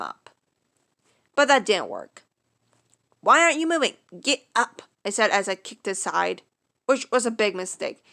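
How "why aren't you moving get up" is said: louder, higher impatient push; impatient urging. Acted. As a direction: demand he move, impatient. Urging patient off floor.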